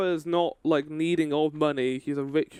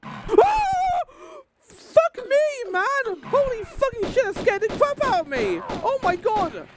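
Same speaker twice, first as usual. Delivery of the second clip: gasping, very high frightened yelling; screaming from a sudden fright; jump scare scream; 4 dB louder, slower than usual. Real, not acted. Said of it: "a genuine jump scare".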